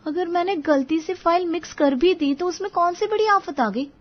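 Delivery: high-pitched defensive wife; protest, throwing it back at him; arguing back. A narrator's reading. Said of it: "किसी बात पर बहस हो जाती है". (बहस में ऊँचे सुर में पलटकर जवाब देती पत्नी)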